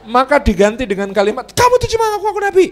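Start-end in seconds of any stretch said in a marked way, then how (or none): 1.5-2.7 high, loud, rapid accusation; angry wife. Acted. Angry wife accuses her husband, loud rapid outburst. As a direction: fire it off fast and high-pitched. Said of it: "kalau buat marah kepanjangan".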